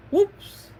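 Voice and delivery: very high, loud acted yelp; mock oops. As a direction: pop out a startled character's cry. Acted surprise at gorilla's fate.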